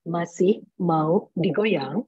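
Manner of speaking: very slow, restrained formal MC; misplaced formality. Shown as a demonstration. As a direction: ask it stiffly, word by word. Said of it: "tapi kita bawainnya formal".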